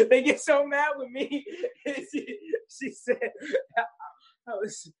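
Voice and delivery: high-pitched, laughing through the words. Real, not acted. Telling the host how fans get mad, laughing. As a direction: break up laughing while telling it.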